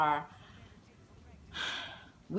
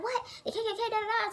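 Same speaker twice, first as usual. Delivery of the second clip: imitated friend; much faster than usual. Friend's reply voiced, laughing.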